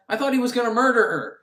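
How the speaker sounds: usual speaking voice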